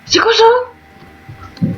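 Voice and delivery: high, slow drawn-out question; surprised. Real, not acted. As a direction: stretch it out, puzzled. Finds something, drawn out.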